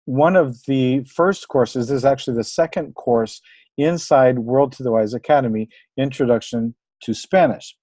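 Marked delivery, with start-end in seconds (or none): none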